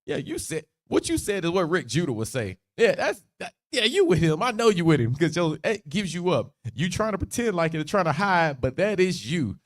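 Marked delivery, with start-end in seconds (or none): 2.8-9.6 higher-pitched, insistent calling out; mock accusation. Bystanders recognising Peter by his talk, insistent accusation. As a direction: catch him out and press the point.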